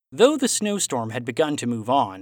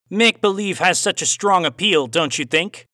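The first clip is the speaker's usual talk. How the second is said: louder, raised Aussie voice with a cowboy twang; Aussie cowboy accent; 5 semitones higher, 5 dB louder than usual. A narrator's reading. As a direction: play the villain Aussie, hint of cowboy twang. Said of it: "Aussie accent with a hint of cowboy twang".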